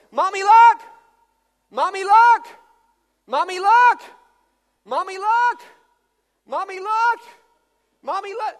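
put-on loud, high repeated call of a small child, fading at the end; a three-year-old pleading for attention; insistent child calling.

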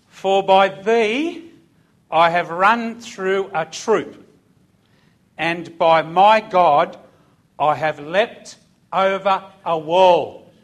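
slow, loud preaching read; scripture reading. Acted. Preacher reads the psalm to the congregation, slow and loud. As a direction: proclaim the verse slowly.

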